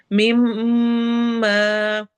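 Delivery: मिम्मा with a held, humming nasal m; nasal ghunna. Shown as a demonstration. Teacher demonstrates ghunna on मिम्मा, nasal hum. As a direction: hum through the doubled m in मिम्मा. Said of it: "मिम में गुन्ना होगा".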